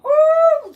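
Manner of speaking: high, loud "woo"; loud whoop. Real, not acted. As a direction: let out a big amused whoop. Host whooping over caller's story.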